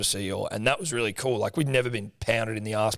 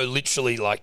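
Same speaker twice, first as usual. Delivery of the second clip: normal talking voice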